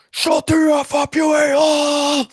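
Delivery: high, loud enraged yelling; furious shout. Retold or imitated. Drunk friend screaming at the cab driver, furious high shout. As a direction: scream him down with full force. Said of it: "Steve goes".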